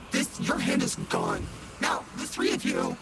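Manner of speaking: creepy deep pumpkin growl; pumpkin head. Acted. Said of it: "I've been this pumpkin voice the whole time".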